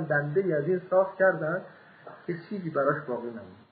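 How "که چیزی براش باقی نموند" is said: ordinary talk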